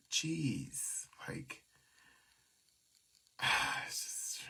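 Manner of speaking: whispered jeez, sighing out; exasperated whisper. Real, not acted. Frustrated streamer venting to viewers, whispered. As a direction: breathe it out in a tired whisper.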